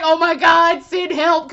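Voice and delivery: loud overwhelmed plea; overwhelmed outcry. Retold or imitated. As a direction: shout it like the scene broke you. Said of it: "I'm sitting up here like".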